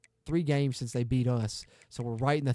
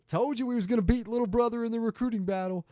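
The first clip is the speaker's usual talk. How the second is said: gloating taunt; 9 semitones higher than usual. Real, not acted. Gloating over rival school.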